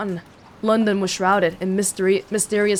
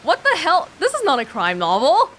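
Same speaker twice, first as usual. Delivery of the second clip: higher, baffled outburst; surprised; 6 semitones higher than usual. Real, not acted. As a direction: react in real disbelief. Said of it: "breaks off, reacting to the text".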